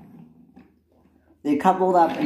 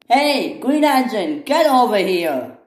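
loud, much higher summons; bossy calling out; 7 semitones higher, 6 dB louder than usual. Acted. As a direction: yell across to order someone over. Inspector calls engine over rudely.

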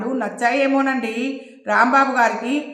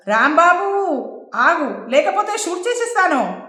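shouted threat; 6 semitones higher than usual. A narrator's reading. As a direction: yell the threat after him.

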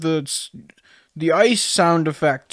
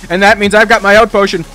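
loud, higher-pitched sportscaster hype; live play-by-play; 7 semitones higher, 8 dB louder than usual. Pretend. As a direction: call it like a thrilling title match. Mock play-by-play commentary.

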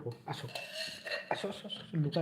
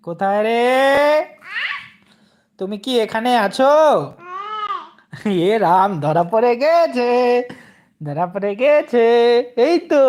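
playful found-you call; 5 semitones higher, 11 dB louder than usual. Pretend. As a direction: announce the child is caught. Seeker finds the hidden child.

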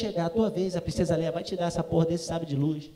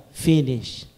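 a lower, dry employee voice; lightly voiced princess; 7 semitones lower than usual. Princess Leia telling dad it's over, lower dry voice. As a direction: say it flat like a bored park worker.

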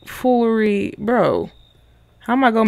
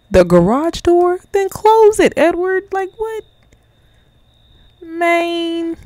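high, squeaky incredulous outburst; exasperated disbelief; 12 semitones higher, 4 dB louder, slower than usual. Real, not acted. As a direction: let your voice shoot way up in disbelief. Exasperated at open garage door.

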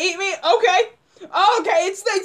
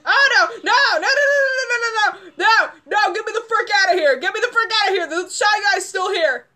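high, frantic pleading; state of panic; 3 semitones higher than usual. Real, not acted. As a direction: rattle off no's in a panic. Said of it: "go into a state of panic".

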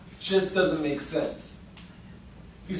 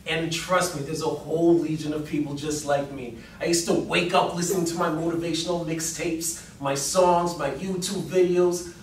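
usual speaking voice

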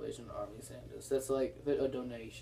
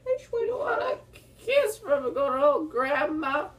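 high, slow sweet grandma voice; doting grandparent; 12 semitones higher, 6 dB louder, slower than usual. Retold or imitated. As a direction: coo like a doting granny. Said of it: "I think grandma voice is like".

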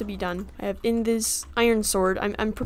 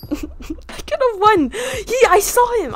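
high, loud, drawn-out lament; exasperated wail; 12 semitones higher, 7 dB louder, slower than usual. Real, not acted. Player lamenting a lost round, exasperated high wail. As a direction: wail it in frustration, stretching the words. Gamer upset at losing the round.

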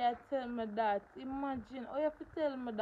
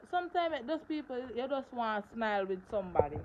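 ordinary talk

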